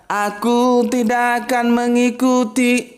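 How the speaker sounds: slow, swaying, drawn-out singsong; mock crooning, stretched out slowly; mock lilting. Shown as a demonstration. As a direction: croon it lazily like a ballad. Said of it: "Nggak mungkin dia mendayu-dayu".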